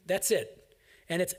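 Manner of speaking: ordinary talk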